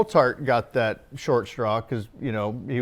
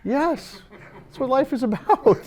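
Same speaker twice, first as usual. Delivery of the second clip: cheeky reply; 12 semitones higher than usual. Retold or imitated. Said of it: "I'm like".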